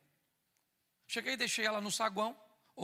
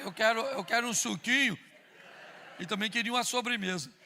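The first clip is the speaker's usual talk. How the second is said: louder, pushing list of wants; louder demanding order; 2 semitones higher, 7 dB louder than usual. Retold or imitated. Husband ordering dinner from his wife, demanding. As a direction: rattle off demands, pressing.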